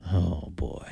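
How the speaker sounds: a low, quiet groan of dread; low muttered oh boy. Retold or imitated. Host recalling his first reaction to Trump's remark, low quiet mutter. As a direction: mutter it under your breath, bracing for trouble.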